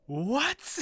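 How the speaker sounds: high, loud mock shock; shocked what. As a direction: gasp it out in disbelief. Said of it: "you're just like".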